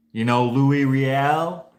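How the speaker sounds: boss's attempted French accent, loud and drawn out. Old boss quizzing an employee, imitated with an attempted French accent. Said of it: "try to say it in a French accent".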